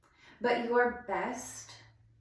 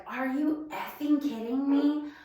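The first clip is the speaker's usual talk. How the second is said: raised, higher mock yell at the husband; retold screaming; 4 semitones higher, 5 dB louder than usual. Wife re-enacting her yell at her leaving husband, loud and high. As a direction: shout it the way she yelled in the driveway. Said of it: "And I'm just screaming at him".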